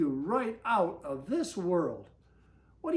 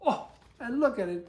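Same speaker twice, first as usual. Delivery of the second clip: usual speaking voice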